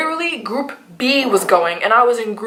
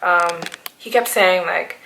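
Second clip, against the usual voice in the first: usual speaking voice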